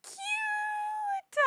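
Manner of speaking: long high squeal; excited squeal. Real, not acted. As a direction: squeal over something adorable. Squealing at favorite cards.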